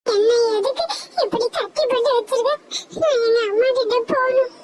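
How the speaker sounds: high, tearful plea to the eagle; a trapped child wanting her mother; crying little girl voice; captured little girl crying. Acted.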